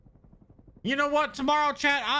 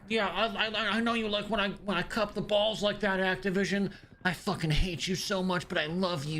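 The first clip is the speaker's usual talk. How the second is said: mock simp; 4 semitones lower than usual. Mock fan simping for Activision.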